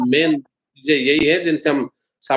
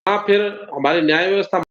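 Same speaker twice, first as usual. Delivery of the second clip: ordinary talk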